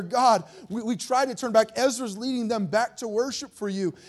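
plus haut et plus fort, suppliant Dieu; pleading prayer. Retold or imitated. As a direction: implorer avec ferveur. Voices Nehemiah's prayer to God.

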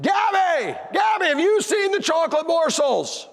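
high, drawn-out shout; hollering. Retold or imitated. Dad calling his daughter about missing chocolate, hollering. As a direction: shout for a kid across the house. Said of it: "I hollered out to my daughter".

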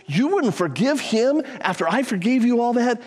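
acted-out indignant question; retold indignation. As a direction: confront the servant in mock outrage. Retold master confronts unforgiving servant.

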